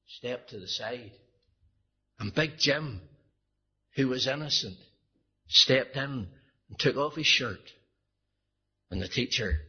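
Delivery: normal talking voice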